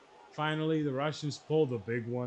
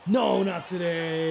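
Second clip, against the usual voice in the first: loud, drawn-out, higher-pitched cry; roaring refusal as the goal goes in; defiant shout; 5 semitones higher, 11 dB louder, slower than usual. Real, not acted. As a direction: shout it out slowly in triumph.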